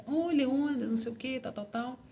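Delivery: gentle teacher. Retold or imitated. Jiu-jitsu teacher welcomes shy Leônidas, gently and kindly. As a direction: greet the shy boy kindly, like a caring teacher. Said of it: "gentil, carinhoso".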